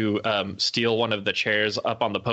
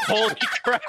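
amazed; 10 semitones higher than usual. Retold or imitated. Recalling his shocked reaction to her boast. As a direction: react stunned and laughing. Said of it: "I was like".

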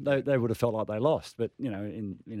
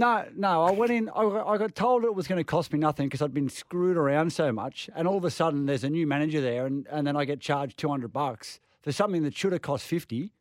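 higher, louder, fired-up complaint; angry rant; 5 semitones higher, 3 dB louder than usual. Real, not acted. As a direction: vent it at full steam, pitch climbing. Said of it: "Now I'm just angry".

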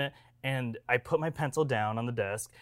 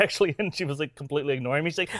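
normal talking voice

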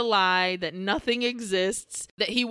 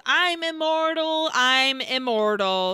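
hammed-up yell, stretching out the words; mock yell; 4 semitones higher, 4 dB louder than usual. Fleeing villain boasting at his pursuers in a mock yell. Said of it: "as he yells".

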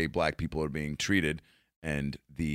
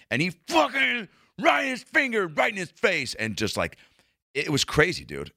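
ordinary talk, nothing marked

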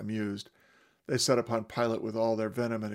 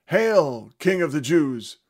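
loud, higher jeering salute; mocking shout; 5 semitones higher, 10 dB louder, slower than usual. Soldiers mockingly saluting Jesus. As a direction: hail him in mockery. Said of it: "they shouted and saluted him".

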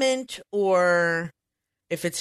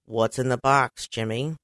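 Jack Nicholson impression; 8 semitones lower, 4 dB quieter, slower than usual. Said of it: "I can't do a Jack Nicholson".

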